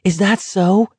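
high-pitched, challenging cry; acted indignant outcry. A narrator's reading. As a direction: throw the question back in a high cry. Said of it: "Garrity cried".

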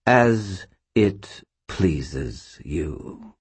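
very slow, disdainful drawl. Acted. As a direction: stretch it out with contempt. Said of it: "speaking noticeably more slowly".

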